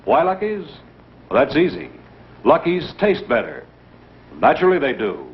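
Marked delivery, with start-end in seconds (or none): none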